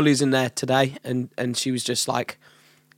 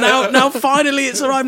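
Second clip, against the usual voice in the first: high, louder imitated lament; freaking out; 11 semitones higher, 5 dB louder than usual. Imitating the artist bemoaning her lost record, freaking out. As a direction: mimic upset, building in volume. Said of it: "she'd be freaking out".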